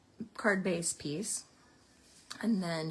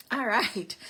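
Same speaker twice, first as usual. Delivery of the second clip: still out of breath; 3 semitones higher, 7 dB louder than usual. Real, not acted. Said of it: "out of breath".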